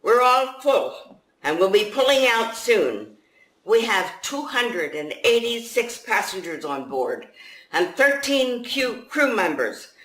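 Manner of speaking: captain addressing the refugees below, loud and high; ship captain. Acted. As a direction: announce it from the top of the ladder. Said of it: "the captain stood ... and said".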